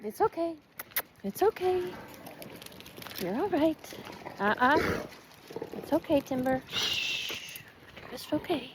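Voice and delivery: high, soft reassuring coo; gently calm a scared dog; soothing the dog. Real, not acted. Soothing her frightened dog.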